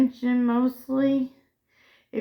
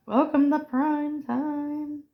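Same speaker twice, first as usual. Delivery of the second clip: drawn-out, higher announcer-like catchphrase; movie catchphrase; 5 semitones higher, slower than usual. Acted. Quoting a horror movie line, mock-grand and drawn out. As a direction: deliver the famous line slowly, stretched out.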